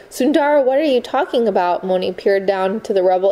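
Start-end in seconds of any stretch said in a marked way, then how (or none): 0.1-1.8 higher, startled call of her name; alarmed call. A narrator's reading. Moni alarmed by Sundara's words.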